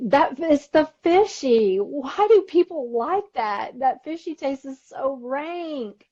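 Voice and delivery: high, loud, drawn-out revulsion; real disgust. Real, not acted. Cohost complains to host about fishy sardines, disgusted. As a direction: stretch the words out in exaggerated distaste.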